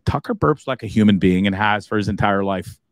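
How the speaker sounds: usual speaking voice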